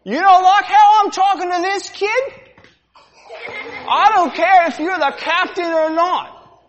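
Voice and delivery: brawling sailor. Acted. Sailor brawling with the captain, loud and high. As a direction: pick the fight with the captain. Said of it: "The demonstration".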